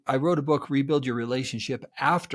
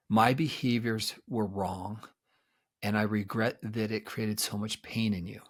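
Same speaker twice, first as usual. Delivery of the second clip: sincere apology; 4 semitones lower, 6 dB quieter than usual. Shown as a demonstration. Therapist modeling an apology to a hurt partner, soft and sincere. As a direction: say it gently, owning the hurt.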